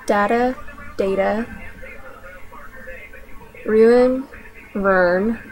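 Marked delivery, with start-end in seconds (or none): 1.0-1.5 "data" with a long A, "day-ta"; Southern "data". Shown as a demonstration. Speaker demos the Southern "data" as "day-ta". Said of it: "second will be Southern version". 4.7-5.4 "ruin" squeezed into one syllable, "rurn"; Southern "ruin" in one syllable; Southern "ruin" as "Vern". Shown as a demonstration. Speaker demos the Southern "ruin" as "rurn". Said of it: "second will be Southern version".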